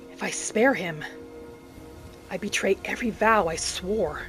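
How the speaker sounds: normal talking voice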